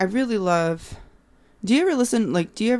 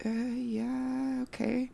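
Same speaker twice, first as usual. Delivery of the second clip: high, soft sheepish reply; awkward shy yes; 7 semitones higher, 9 dB quieter, much slower than usual. Retold or imitated. Recognized at grocery store, shy soft yes. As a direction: shrink a little as you admit it.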